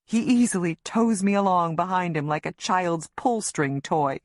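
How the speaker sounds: usual speaking voice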